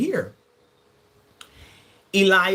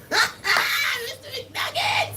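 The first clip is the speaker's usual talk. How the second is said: a shrieked, laughing "Mister McNuggets!" way up high; 3 dB quieter, much slower than usual. Real, not acted. Laughing shriek at a flirting viewer. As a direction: shriek his name through laughter, slowly.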